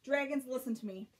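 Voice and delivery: ordinary delivery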